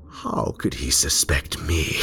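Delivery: usual speaking voice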